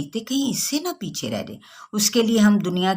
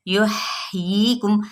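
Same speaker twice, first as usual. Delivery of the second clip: slow recitation; 3 semitones higher, much slower than usual. Shown as a demonstration. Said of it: "models word slowly".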